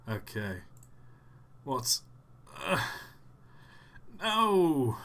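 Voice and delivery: usual speaking voice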